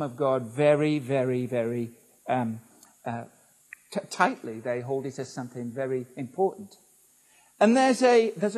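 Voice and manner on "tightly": ordinary talk, nothing marked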